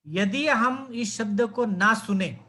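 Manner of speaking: ordinary talk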